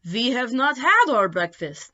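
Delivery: a hint of a hungry vampire. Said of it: "they told us".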